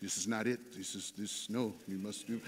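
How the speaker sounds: put-on low, soft Brazilian-accented voice; thick Brazilian accent. Piano teacher telling his student it's not right, thick Brazilian accent. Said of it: "thick Brazilian accent".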